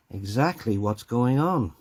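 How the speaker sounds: normal talking voice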